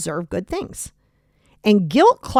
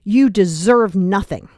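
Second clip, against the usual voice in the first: mock berating; slower than usual. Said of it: "would you literally say".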